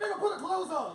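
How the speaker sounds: high, loud game-toy command; Bop It toy voice. Retold or imitated. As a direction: bark it like the toy's announcer. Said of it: "He was like".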